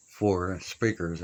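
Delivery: normal talking voice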